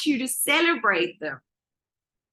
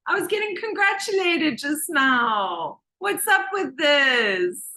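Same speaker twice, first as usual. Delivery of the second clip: higher-pitched mock whine of protest; aggrieved child; 3 semitones higher than usual. Child no longer praised when little, mock aggrieved. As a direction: complain like a wronged little kid.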